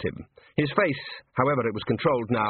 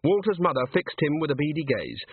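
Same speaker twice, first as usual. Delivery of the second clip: plain reading voice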